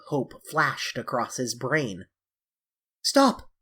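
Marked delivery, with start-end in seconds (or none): 3.0-3.5 high, loud desperate cry; cried out. A narrator's reading. Dorian crying out to stop James Vane. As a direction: cry out to halt him. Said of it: "he cried".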